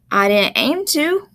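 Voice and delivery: put-on boy's country dialect. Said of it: "with the dialogue in the dialect".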